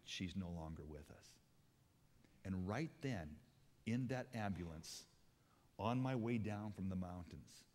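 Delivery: hushed testimony of loss; solemn testimony. Acted. Solemn retelling of wife's death.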